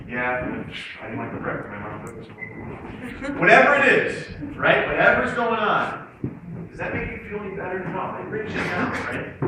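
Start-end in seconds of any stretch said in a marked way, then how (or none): 0.1-3.4 low, soft, downcast answer; glum reply. Acted. Student replying to a Monday greeting, glum. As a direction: answer as if the day is already lost.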